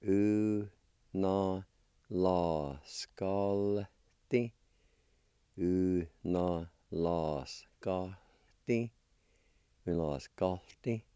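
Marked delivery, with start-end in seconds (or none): 0.0-4.5 very slow, each syllable held; teacher's careful syllable demo. Shown as a demonstration. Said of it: "sound like this". 5.6-8.9 slow, drawn-out syllables; teacher's careful word model. Shown as a demonstration. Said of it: "sound like this".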